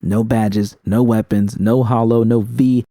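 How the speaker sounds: ordinary delivery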